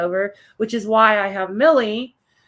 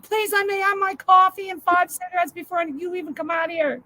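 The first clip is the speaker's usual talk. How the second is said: pleading exasperated; 9 semitones higher than usual. Retold or imitated. Pleading reply retold.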